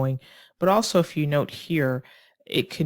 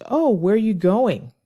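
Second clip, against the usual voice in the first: lifted, louder, interested "oh"; lightly acted dialogue line, higher and livelier; acted woman's question; 5 semitones higher, 5 dB louder than usual. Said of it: "she says".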